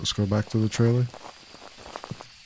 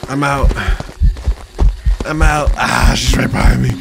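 panicked yelling while fleeing; panicking gamer; 3 semitones higher, 6 dB louder than usual. Real, not acted. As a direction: shout in a real panic, running. Chased by monster in game.

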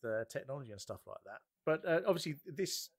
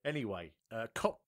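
usual speaking voice

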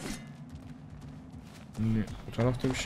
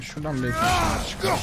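sudden shout. Real, not acted. Gamer yelling at the game.

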